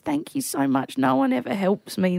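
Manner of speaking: put-on grateful elderly man. Elderly man thanking his helper, softly grateful. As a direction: thank tenderly as a frail old man.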